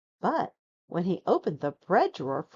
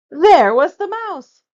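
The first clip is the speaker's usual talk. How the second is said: big surprised reveal; 10 semitones higher, 13 dB louder than usual. A narrator's reading.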